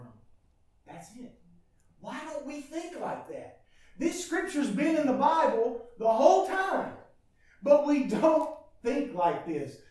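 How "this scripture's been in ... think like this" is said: loud, ringing pulpit cry; fiery preaching. Acted. Pastor to his church, preaching at full force.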